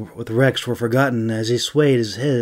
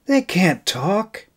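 huffing; 8 semitones higher than usual. Acted. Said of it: "he huffed".

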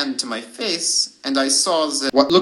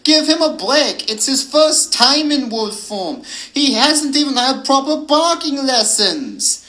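high, raised werewolf retort; howled defence; 10 semitones higher, 4 dB louder than usual. A narrator's reading. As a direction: howl back in his defence. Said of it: "Yulia howled back".

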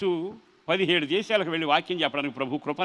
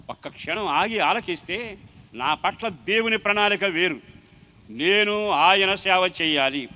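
drawn-out, louder declaration; slow emphatic preaching; 3 semitones higher, 5 dB louder, slower than usual. Acted. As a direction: lean on every word, slowly. Preacher's slowed emphatic point. (నెమ్మదిగా, గట్టిగా ప్రబోధం)

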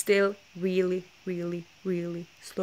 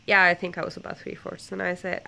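normal talking voice

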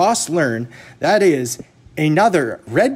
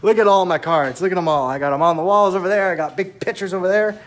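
mock bragging; 4 semitones higher, faster than usual. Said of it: "I'm like".

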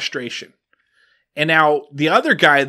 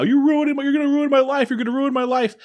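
much higher, a mock tearful plea; imitating crying; 10 semitones higher, faster than usual. Clerk sobs at the guest, imitated in a high, tearful voice. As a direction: whimper it high, like he's falling apart. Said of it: "is crying now".

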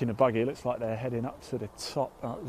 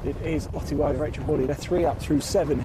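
louder, raised result announcement; loud confirmation; 3 semitones higher, 7 dB louder than usual. Real, not acted. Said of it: "but I can confirm".

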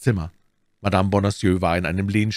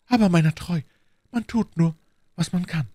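high-pitched, phrase by phrase; offhand self-excuse; 10 semitones higher than usual. A narrator's reading. Milady excuses her poisoning with a grin, offhand. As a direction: excuse yourself in a high, pointed voice.

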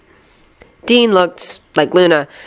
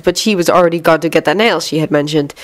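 plain reading voice

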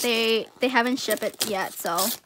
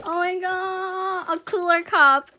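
higher, drawn-out amazement; amazed delight; 5 semitones higher, 5 dB louder, slower than usual. Real, not acted. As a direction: stretch it out in happy surprise. Reacting to surprise gift.

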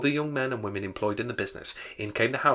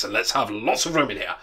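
hearty order, easing from a shout; Fezziwig; 5 dB louder than usual. A narrator's reading. Fezziwig making room for the party, jovial. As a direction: keep the jolly master going, a bit louder than usual. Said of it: "cried old Fezziwig".

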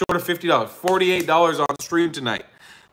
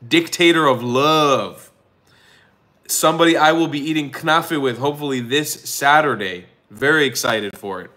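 normal talking voice

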